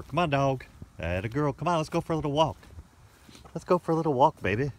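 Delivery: loud high call to a dog. Real, not acted. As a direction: call her out across the yard. Calling dog outdoors.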